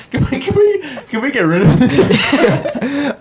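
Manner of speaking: high laughing plea. Real, not acted. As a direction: laugh through the words. Laughing while dropping the bit.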